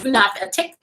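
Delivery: ordinary delivery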